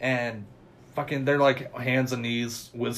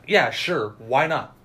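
sarcastic; 3 semitones higher, 8 dB louder than usual. Retold or imitated. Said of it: "obviously not fucking happy".

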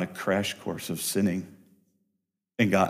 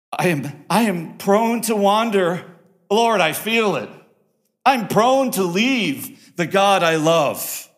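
fervent psalm quote; 9 semitones higher, 7 dB louder, slower than usual. Acted. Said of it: "The psalmist ends with".

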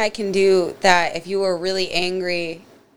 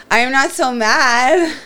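higher, louder and pleased; suddenly happy; 6 semitones higher, 6 dB louder than usual. Acted. Wife placated by McDonald's, suddenly happy. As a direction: lift it up as the mood turns.

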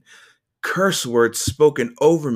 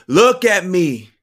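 louder, higher and slow; slow, raised-voice plea; insistent pleading; 7 semitones higher, 8 dB louder, slower than usual. A narrator's reading. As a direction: demand it, stretching each word. Urging the boy, repeated insistently.

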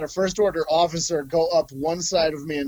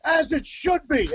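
slow, much higher and self-satisfied; smug officer; 12 semitones higher, slower than usual. Retold or imitated. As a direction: drawl it out like a pleased officer, higher. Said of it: "And he's like".

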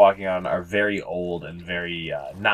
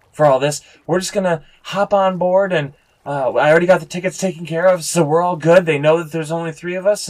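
put-on higher, louder young-man character voice; eager young guide; 8 semitones higher, 6 dB louder than usual. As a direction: explain the trip in a raised voice.

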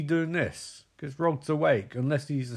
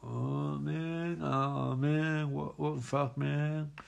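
a hint of a groggy, dazed boyfriend, softer; groggy Johnny Depp; 4 dB quieter, much slower than usual. Boyfriend just woken up, confused, lightly voiced and soft. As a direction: mumble it like you've just been woken. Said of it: "And he's like".

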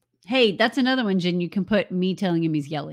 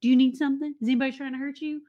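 a lightly voiced worried dog, rising higher; voicing the dog; 5 semitones higher than usual. Host voices the dog fussing over the baby, lightly worried. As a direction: play the anxious dog checking on the baby. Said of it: "The dog's like".

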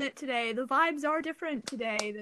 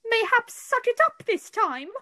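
playful mock scolding; 10 semitones higher, 5 dB louder than usual.

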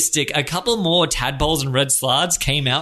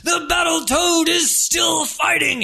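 pumped-up wrestler; 13 semitones higher than usual. Acted. The Battletoad slapping himself, shouting his own hype. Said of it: "He starts slapping himself".

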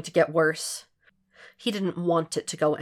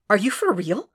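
higher, combative disbelief; fed up; 4 semitones higher than usual. A narrator's reading. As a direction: challenge them, spoiling for a fight. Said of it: "sounding fed up and spoiling for a fight".

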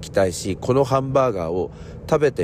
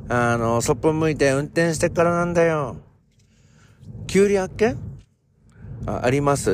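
raised, folksy Ibaraki country dialect; thick rural Ibaraki-ben, chatty and higher; Ibaraki dialect skit; 4 semitones higher than usual. Acted. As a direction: play an old Ibaraki farmer chatting with a neighbour. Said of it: "こういうやつなんですよ".

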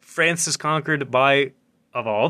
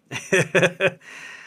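amused burst of laughter; real laughter; 6 semitones higher than usual. Real, not acted. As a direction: crack up at the joke. Cracking up at the joke.

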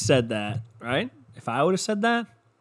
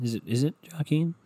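usual speaking voice

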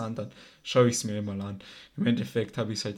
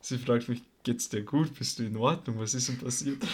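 ordinary talk

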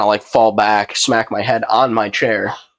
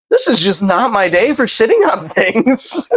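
higher, slow comic lament; retold exasperation; 10 semitones higher than usual. Storyteller after his second fall, comically exasperated. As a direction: stretch it out in mock despair.

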